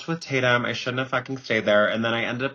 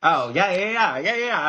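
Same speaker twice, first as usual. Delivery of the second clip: bright, higher, eager nodding yeahs; fake understanding; 5 semitones higher than usual. Bluffing to Aussie guys, high eager yeahs. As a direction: pretend you totally got it, brightly. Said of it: "And I'm like".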